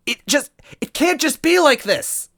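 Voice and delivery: put-on high, loud girlish cry; Toadette crying out. Toadette crying out as Luigi drags her away. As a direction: cry out struggling against him. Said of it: "she cried".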